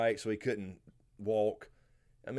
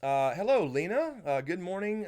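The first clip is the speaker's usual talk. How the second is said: cheery greeting; 8 semitones higher, 5 dB louder than usual. Real, not acted. Greeting a viewer in chat.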